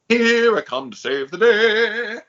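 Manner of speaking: high, slow heroic cartoon mouse singing out; slow high superhero call; Mighty Mouse. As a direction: belt it like a flying cartoon hero. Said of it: "Like Mighty Mouse".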